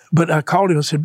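ordinary talk, nothing marked

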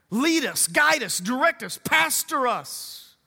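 lifted, ringing prayer over the church; fervent prayer. Acted. Pastor leading the church in a loud, lifted prayer. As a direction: pray it out loud for the whole church.

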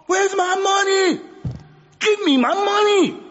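a creditor going off, demanding payment; angry Bedouin demand. Retold or imitated. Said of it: "he was emphatic and excited".

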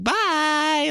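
chirpy high-pitched goodbye; high happy bye. Retold or imitated. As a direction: squeal it like an excited kid. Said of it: "He's like".

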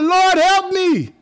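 high, loud, slow wail; drawn-out anguished cry for help; desperate plea. Acted. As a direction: cry out to God from trouble. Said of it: "He's talking to God".

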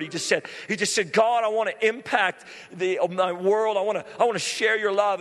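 high, loud revival preaching; climbing evangelist fervor; fired-up preaching. Acted. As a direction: preach it out full force to the crowd. Preaching at full force.

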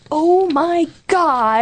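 slow, drawn-out mock horror; retold dismay. Roommate above her, drawn-out horrified mutter to boyfriend. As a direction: groan it out, hiding under the covers. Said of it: "I'm like".

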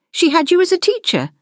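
high, astonished question; surprised. A narrator's reading. Gwendolyn learning about her sister, high surprise. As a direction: ask it high, taken aback.

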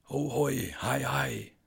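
the same unseen voice, now drifting away; fainter eerie greeting. Acted.

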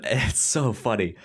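ordinary talk, nothing marked

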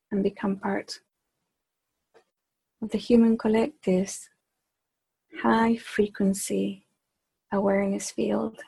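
measured meditation voice with long pauses; unhurried, evenly paced meditation leading; guided meditation. Acted. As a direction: guide the group inward, phrase by phrase. Leads a group meditation.

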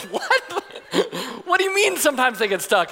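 high-pitched, alarmed disbelief; acted outraged astonishment; incredulous dad. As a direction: throw it back in mock panic. Alarmed father challenges the lifeguard.

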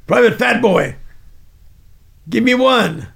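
loud barked drill command; sergeant's order. Retold or imitated. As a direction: bark the order at the recruit. Sergeant taunting recruit.

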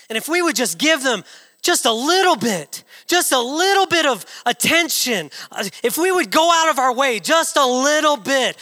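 high, loud, urgent sermon appeal; fervent preacher building with repeated phrases; full-force preaching. Real, not acted. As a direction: plead with the congregation at full force.

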